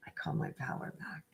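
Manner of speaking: breathy whispered repeat of the line; whispered lyric. Retold or imitated. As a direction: whisper the chorus line to yourself. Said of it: "the chorus is".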